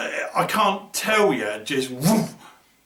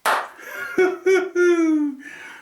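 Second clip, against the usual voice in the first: laughing high, almost crying; a giddy laugh that nearly tips into tears; 12 semitones higher than usual. Real, not acted. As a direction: burst out in a high, teary laugh. Reacting to mirror news.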